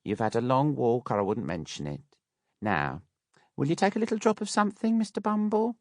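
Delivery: very high, fast wheedling offer; coaxing Mrs Mann. A narrator's reading. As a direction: tempt him like a sly hostess.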